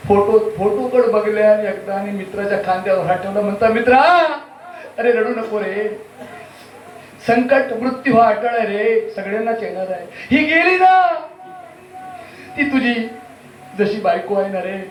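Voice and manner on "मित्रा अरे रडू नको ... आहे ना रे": milk the grief comically; hammed-up lament. Consoling the friend over his wife, hammed-up high lament.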